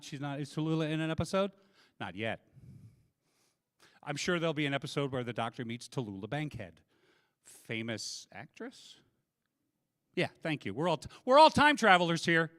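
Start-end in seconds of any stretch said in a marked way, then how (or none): none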